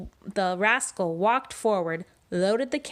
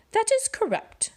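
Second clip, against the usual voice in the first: much higher, prissy little voice; prim rascal. Acted. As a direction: answer like a proper schoolboy. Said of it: "said the rascal".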